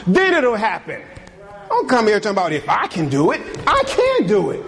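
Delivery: full-force preaching. Acted. Pastor to fathers on Father's Day, preaching at full force. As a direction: preach it hard to the congregation, climbing.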